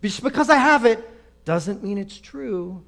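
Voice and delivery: half-hearted imitation of him yelling after her; doctor calls after the storming patient, a mock yell; retold yell. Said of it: "He yells".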